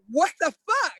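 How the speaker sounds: imitated high, incredulous outburst; laughing disbelief. Postal worker friend challenges the officer she knows, laughing disbelief. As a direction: act out a friend's amused disbelief. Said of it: "she kinda laughed and she goes".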